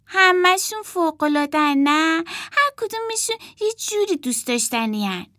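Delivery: put-on high, delighted child voice; gushing little girl. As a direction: adore every toy. Emma voiced in story.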